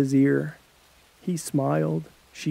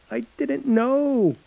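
tearful; 10 semitones higher, slower than usual. Acted. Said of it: "as he wiped away tears".

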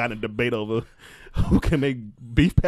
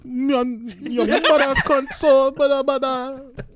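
high, slow laughing gibberish in a Caribbean lilt; mock Caribbean mother; 11 semitones higher, much slower than usual. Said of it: "All I heard was".

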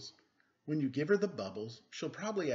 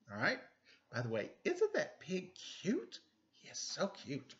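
ordinary delivery

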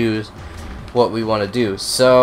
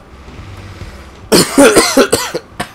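harsh chesty cough from a cold; hard cough; 12 semitones higher, 5 dB louder than usual. Real, not acted. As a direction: cough it out, lungs aching. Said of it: "kind of caught a cold".

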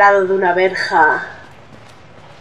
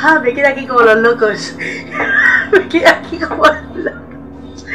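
breathless laughter through the words; laughing. Real, not acted. Player laughing at her own panic, giggling. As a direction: laugh it off after the scare.

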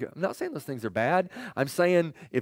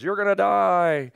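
mock menace, drawn out slowly and higher; retold threat; 5 semitones higher, 4 dB louder, slower than usual. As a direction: threaten like the king's wife, slow and raised. Acted threat from king's wife.

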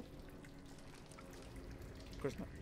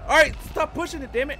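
loud, high-pitched protest; agitated yelling; 10 dB louder than usual. Real, not acted. Player pushed by a game enemy, protesting loudly. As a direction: yell at it to stop.

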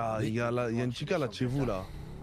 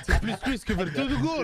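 laughing; 13 semitones higher, 6 dB louder, slower than usual. Real, not acted. Laughing at the insult.

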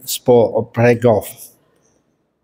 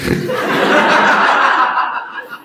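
high laugh. Real, not acted. Laughing at own punchline.

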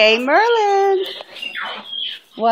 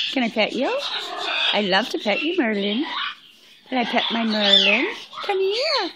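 soft coaxing; 3 semitones lower, 6 dB quieter, faster than usual. Real, not acted. Coaxing pet bird closer.

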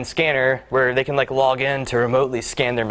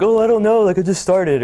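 higher-pitched mock innocence; playing clueless newcomer; 6 semitones higher, 3 dB louder than usual. New executive dodging a question, mock clueless. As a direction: act like a clueless new hire. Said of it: "play the card like".

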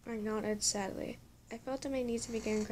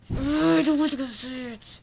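very loud, higher-pitched whine of protest; exasperated protest; 4 semitones higher, 11 dB louder than usual. Real, not acted. Reader refusing the person who interrupts, exasperated yell. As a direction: yell it out, fed up.

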